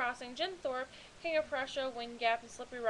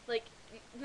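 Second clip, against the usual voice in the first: ordinary delivery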